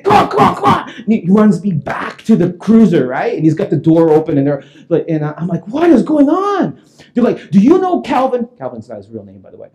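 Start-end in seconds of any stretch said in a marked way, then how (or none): 0.0-0.9 high, urgent rush of words; urgent cop. Retold or imitated. Cop pulling him out of the house, urgent. 5.7-6.8 high, slow exclamation of confusion; acted bewilderment. Homeowner to the cop at the cruiser, bewildered. 7.5-8.5 high, pressing cop question; urgent questioning. Retold or imitated. Cop questioning the homeowner about Calvin, urgent.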